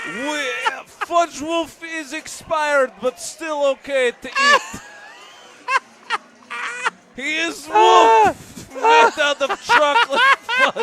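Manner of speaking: mascot voice. Pretend. Mock mascot pitch voice.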